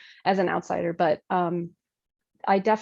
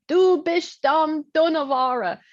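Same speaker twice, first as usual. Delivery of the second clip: higher, louder, slow scolding in Dutch; scolding old woman; 7 semitones higher, 5 dB louder, much slower than usual. Retold or imitated. Old church woman scolding the young pastor, slow and loud. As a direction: scold her like a stern grandmother. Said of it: "one of them came up to me and said".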